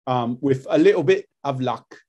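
a little bit of luck in Cockney; Cockney accent. Shown as a demonstration. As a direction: demo working class London Cockney. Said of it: "the sort of Cockney".

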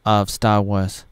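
usual speaking voice

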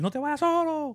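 high, pleading imitation of the wife; wife's plea. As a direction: beg him not to go alone, high and mocking. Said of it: "Y Soli". (imitación aguda de la esposa rogando)

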